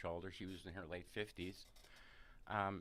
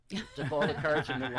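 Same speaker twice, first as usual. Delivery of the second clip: laughing; 4 semitones higher, 13 dB louder than usual. Real, not acted. Laughing while telling story.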